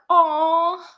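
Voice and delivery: sad aw. Real, not acted. Grieving the friends' last goodbye, sad aw. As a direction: let out a sorry little aw. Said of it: "And I was just like".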